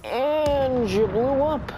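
high, loud, slow triumphant crow; gleeful exclamation. Real, not acted. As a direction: savour the explosion, drawn out. Enemy explodes in game.

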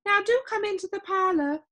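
high, gentle lady's voice; gentle hostess. A narrator's reading. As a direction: invite a guest in, high and lilting.

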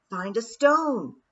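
loud, slow urgent scream; screamed command. A narrator's reading. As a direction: scream the order. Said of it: "her third thought screamed".

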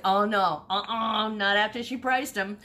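mock refusal. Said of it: "And she's like".

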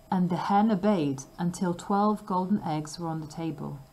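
usual speaking voice

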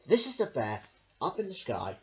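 usual speaking voice

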